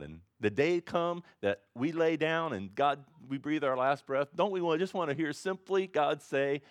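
heartfelt sermon build; preaching. Real, not acted. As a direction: build the plea toward God. Pastor preaching with force.

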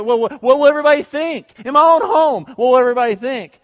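high, loud fretting; agitated worry. Acted. Worshipper fearing others' opinions at home, agitated worry.

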